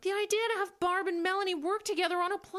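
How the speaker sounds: shouting it at full volume; loud, high and frantic; yelling. Acted. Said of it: "why are you yelling then".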